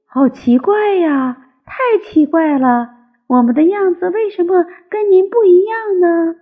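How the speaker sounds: put-on high, childlike wondering; puzzled tadpoles. Tadpoles question frog mother, high and puzzled. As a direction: ask in a little one's high, puzzled voice.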